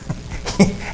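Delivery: quick breathy chuckle; soft laugh. Real, not acted. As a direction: laugh at the absurd picture. Laughs at creepy joke.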